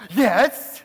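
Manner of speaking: loud eager yes; eager bride. Retold or imitated. Bride answering her new name, loud and eager. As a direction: burst out like she's been waiting. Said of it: "and she'll go".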